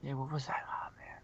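breathy hushed whisper. Real, not acted. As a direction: drop to a whisper. Whispered aside.

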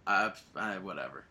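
ordinary talk, nothing marked

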